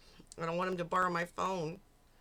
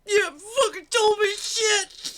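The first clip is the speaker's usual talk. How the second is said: high, loud whiny mock of her line; shrill-free but much higher, louder mockery; mocking crying girlfriend; 12 semitones higher, 10 dB louder than usual. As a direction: parrot her tearful complaint, exaggerated.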